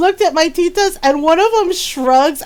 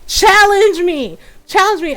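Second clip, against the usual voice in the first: imitated challenge; 5 semitones higher than usual. Grandmother dares the family to doubt her, mock challenge. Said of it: "she stared at the camera like".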